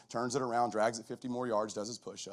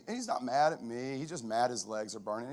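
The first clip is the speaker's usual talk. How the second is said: ordinary delivery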